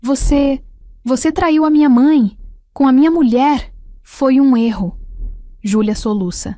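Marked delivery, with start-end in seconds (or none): 0.0-3.7 high, loud, voice cracking; cracking shout. A narrator's reading. Son confronting his father over betrayal, cracking shout. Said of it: "A voz dele racha". 4.0-5.0 cracking voice. A narrator's reading. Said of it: "A voz dele racha".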